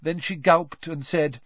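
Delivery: ordinary delivery, nothing marked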